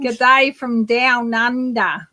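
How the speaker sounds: slow, drawn-out Aussie G'day from down under; Australian accent. Pretend. Host doing her Australian accent for a viewer, slow Aussie drawl. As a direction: ham up an Australian greeting. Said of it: "that's my Australian".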